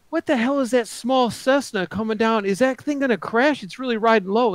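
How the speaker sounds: high-pitched retold alarm; alarmed disbelief. Retells own alarmed question.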